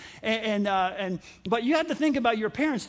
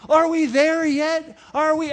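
put-on whiny kid; 3 semitones higher, 4 dB louder than usual. Kid in the back seat asking dad, slow whine. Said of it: "Do you remember this phrase".

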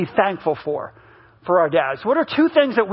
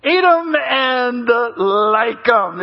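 each word slowly laid out like a stern father; mild slowed-down dad voice; slow, drawn-out order; much slower than usual. Retold or imitated. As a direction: slowly spell out the rule.

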